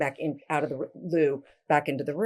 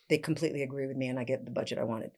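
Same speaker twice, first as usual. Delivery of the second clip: ordinary delivery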